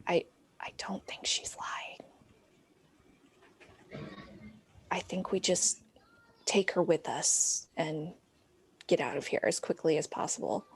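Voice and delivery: soft, secretive whisper easing into low murmur; hushed whisper. Acted. Muriel confiding quietly to the group, hushed whisper. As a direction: whisper it just to your companions. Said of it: "whisper just so they can hear".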